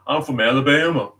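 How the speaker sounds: a quick Alabama accent impression; casual Southern Alabama accent. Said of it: "if you be someone from Alabama".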